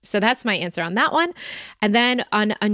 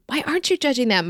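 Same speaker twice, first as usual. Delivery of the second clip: "Why aren't you judging them" pitched much higher, pressing; higher pleading repeat; 7 semitones higher, faster than usual. Shown as a demonstration. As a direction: press the question again, higher. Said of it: "ask why not".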